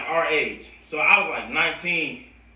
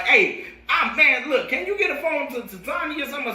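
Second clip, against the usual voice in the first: urgent plea; 9 semitones higher than usual. Retold or imitated. Pleading call to sister.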